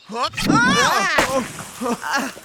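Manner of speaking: loud high yelp of alarm; startled cry. Acted. Hugh yelps as the axe flies out of his hands, startled.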